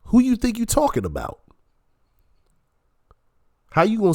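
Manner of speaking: very high-pitched, incredulous demand; indignant challenge. Real, not acted. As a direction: challenge them with pitch leaping up, then dropping. Indignant retort to the played clip.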